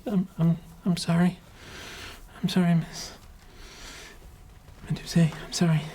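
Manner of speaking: put-on high, sheepish little-kid voices; apologetic children. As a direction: mumble it like children caught being mean. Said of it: "terrible people and say".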